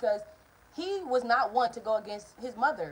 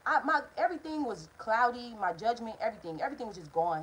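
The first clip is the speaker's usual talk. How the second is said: ordinary talk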